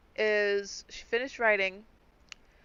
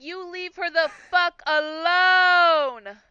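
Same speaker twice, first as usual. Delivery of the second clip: slow, louder mock threat; angry warning; 6 semitones higher, 6 dB louder, slower than usual. Character warning the monster off her bird, slow and angry. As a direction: drag out the threat to leave her alone. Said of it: "I'm like".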